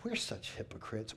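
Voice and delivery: breathy whisper, almost no voice; whispered aside. Real, not acted. Preacher to church, admitting hypocrisy: whispered aside. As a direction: confess it under your breath. Self-critical aside to the congregation.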